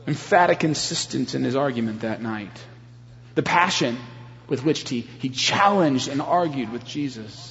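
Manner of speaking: normal talking voice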